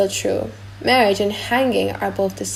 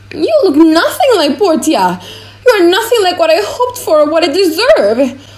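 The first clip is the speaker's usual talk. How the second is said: raised, louder offended cry; indignant outburst; 6 semitones higher, 7 dB louder than usual. Acted. The Prince, cheated of Portia, rages at the fool's portrait, loud and indignant. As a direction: let the insult sting and push it out higher and louder.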